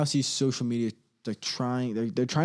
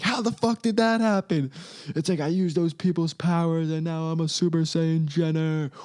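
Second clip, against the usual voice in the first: mock anime villain; 6 semitones higher than usual. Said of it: "flying around like a Super Saiyan".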